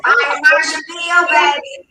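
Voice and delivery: thrilled to remember Fire Marshall Bill; excited recall. Real, not acted. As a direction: burst out with glee at the memory. Excited recall of favourite character.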